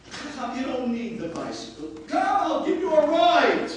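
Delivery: rising to a loud, high plea; insistent offer. Retold or imitated. Rabbi retelling how he pressed the Chacham to accept a ride. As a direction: urge him to take the ride. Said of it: "the way they taught us in yeshiva".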